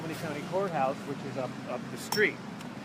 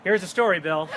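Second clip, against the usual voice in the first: excited outburst; 3 semitones higher, 5 dB louder than usual. Retold or imitated. Guide retells his own excited reaction.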